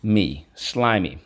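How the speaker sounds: "me" then a slow "slimy"; second syllable me. Shown as a demonstration. Teacher giving the second syllable, then the word. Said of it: "Second syllable".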